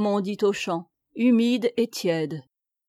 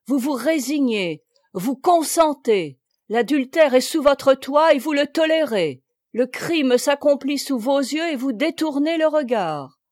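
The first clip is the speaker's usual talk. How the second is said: louder and higher, building to a shouted accusation; outraged outcry; 4 semitones higher, 6 dB louder than usual. A narrator's reading. Priest rebukes Jeanne, rising to a loud outraged cry. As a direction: denounce her weakness, climbing to the last word. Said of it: "s'écria".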